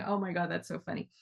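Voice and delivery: ordinary delivery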